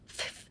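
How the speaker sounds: "fifth" as an almost voiceless puff; huffed F. Shown as a demonstration. Teacher likening it to hushing a dog, huffed. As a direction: let "fifth" be only a soft huff of air. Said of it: "little huff at the end".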